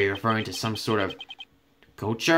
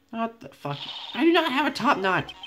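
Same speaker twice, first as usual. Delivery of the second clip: indignant outburst; 12 semitones higher than usual. Acted. Lawyer protesting that he has no top knot, indignant and high. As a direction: bristle and yelp back indignantly. Character offended by a description.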